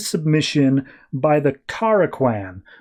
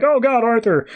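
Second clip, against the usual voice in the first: mock freak-out, pitched high; Mera; 8 semitones higher than usual. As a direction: imitate a panicked cry, without full force. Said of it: "And Mera freaks out".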